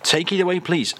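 normal talking voice

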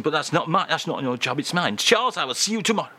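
usual speaking voice

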